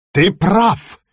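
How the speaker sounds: put-on young witch flaring up, cross; angry cry. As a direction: throw it back at him in anger. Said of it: "крикнула она гневно".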